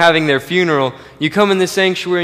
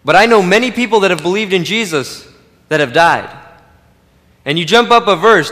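ordinary talk

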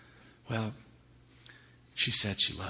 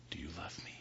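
low whispered question; husband whispering to his terrified wife; sinister whisper; 3 semitones lower, 10 dB quieter, faster than usual. Acted. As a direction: whisper it menacingly.

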